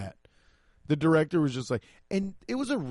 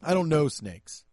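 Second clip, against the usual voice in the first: mock confidence. Shown as a demonstration. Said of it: "It was too long of a pause".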